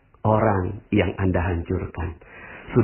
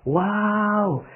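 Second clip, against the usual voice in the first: impressed praise; 9 semitones higher, much slower than usual. Shown as a demonstration. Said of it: "coba Anda ngomongnya gini".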